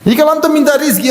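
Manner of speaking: usual speaking voice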